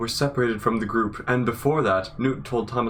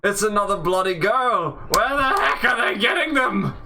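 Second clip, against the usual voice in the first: mock British Newt; 9 semitones higher than usual. Newt complaining about another girl, mock British. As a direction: whine it up high in a put-on British voice. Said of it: "Newt looking at you saying".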